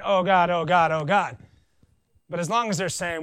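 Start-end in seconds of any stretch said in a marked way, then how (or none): 0.0-1.4 louder mock cry of panic; mock screaming. Preacher imitates someone screaming to God, loud. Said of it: "someone's screaming".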